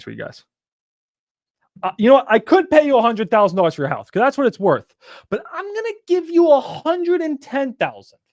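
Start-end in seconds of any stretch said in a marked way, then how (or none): 1.8-4.9 louder, pushy pitch to the seller; loud salesman pitch. Shown as a demonstration. Coach demos a pitch to the seller, loud and pushy. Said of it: "You say". 5.3-8.2 high, slow, showy offer; grand salesman offer. Shown as a demonstration. Coach plays buyer topping the price for the seller, high and showy. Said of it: "You say".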